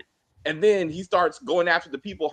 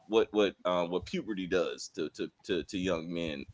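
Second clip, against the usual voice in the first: sliding much lower and softer; mock deep voice; 11 semitones lower, 8 dB quieter than usual. Host joking about boys' voices breaking, a put-on deep voice. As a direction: sink into a joking bass.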